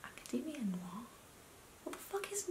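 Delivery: whispered disbelief; baffled whisper. Retold or imitated. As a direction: hush it out, confused. Her baffled reply to mom.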